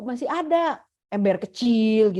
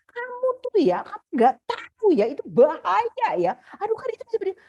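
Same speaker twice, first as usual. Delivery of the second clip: mock scolding; 8 semitones higher than usual. Parent scolding child about song.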